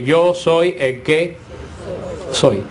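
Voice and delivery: "Yo soy el que soy" muy despacio, cayendo grave al final; cita solemne lenta. Shown as a demonstration. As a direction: recita el nombre divino con peso. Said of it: "De las cuatro primeras consonantes". (pronuncia "Yo soy el que soy" lento y solemne)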